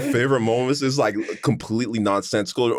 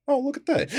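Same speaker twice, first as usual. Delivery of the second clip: high, softer mock marvel; mock police officer; 13 semitones higher, 3 dB quieter than usual. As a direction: marvel at it lightly, pitched up. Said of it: "the police officer's like".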